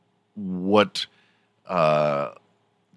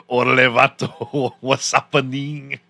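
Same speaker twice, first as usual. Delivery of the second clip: put-on laid-back East LA Mexican accent, higher; 5 semitones higher than usual. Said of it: "straight up born in East L A Mexican".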